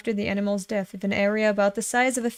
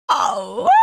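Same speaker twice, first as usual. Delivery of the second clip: a jump way up in pitch, loud; shocked oh; 11 semitones higher, 5 dB louder than usual. Real, not acted. Reader reacting to a shocking panel, startled. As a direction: caught off guard by what you just saw.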